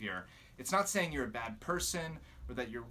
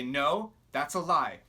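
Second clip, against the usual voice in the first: louder, decisive no; firm rebuke; 6 dB louder than usual. Shown as a demonstration. Answering the enemy's accusation, loud and decisive. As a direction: stand up to it out loud. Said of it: "you say".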